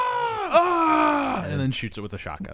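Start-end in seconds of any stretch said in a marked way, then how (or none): 0.0-1.4 long, high mock yell; answering scream. Host playing Rick yelling at the mummy, screaming. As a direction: scream right back at the monster. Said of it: "And he goes".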